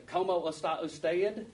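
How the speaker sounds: put-on slow Spanish in a thick Alabama southern accent; Spanish phrase in a broad American southern accent. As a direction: say it like an Alabama missionary trying his Spanish. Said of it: "Speak Spanish with a southern accent".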